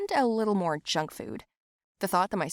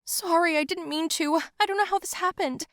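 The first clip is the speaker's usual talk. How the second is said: high, tearful pleading apology; crying; 10 semitones higher than usual. Acted. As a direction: sob out the apology. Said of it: "I said and cried".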